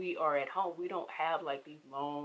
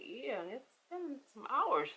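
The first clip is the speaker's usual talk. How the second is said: sarcastic; 8 semitones higher, slower than usual. Retold or imitated. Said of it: "I ain't said that to her, but in my head".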